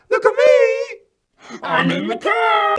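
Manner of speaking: put-on small child's high, drawn-out yell; calling out. A narrator's reading. Three-year-old out the car window to his family, calling out. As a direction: shout it out with gleeful show-off pride. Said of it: "he looked out the window and called out".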